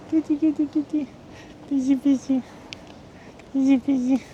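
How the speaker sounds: very high sing-song kitty call; cat-calling voice. Real, not acted. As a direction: coax a cat out in a tiny high voice. Coaxing a stray cat.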